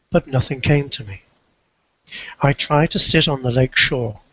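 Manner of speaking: ordinary delivery, nothing marked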